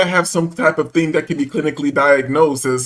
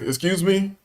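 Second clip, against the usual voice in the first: calm, unraised voice; 3 semitones higher, 6 dB quieter, slower than usual. Retold or imitated. Man questioning the store clerk, calmly and softly. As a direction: softly put question, free of hostility. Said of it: "didn't raise my voice".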